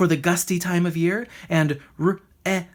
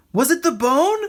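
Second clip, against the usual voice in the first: raised, louder call; 9 semitones higher, 5 dB louder than usual. A narrator's reading. Rocket calling up to the silent bird, louder. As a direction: call up to the tree. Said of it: "he called to the bird".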